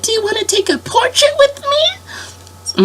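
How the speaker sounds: very high sweet princess offer. Retold or imitated. Princess offering a portrait in a sweet, very high voice. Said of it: "they'd be like".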